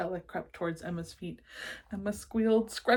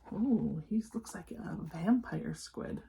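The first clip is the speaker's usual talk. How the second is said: ordinary delivery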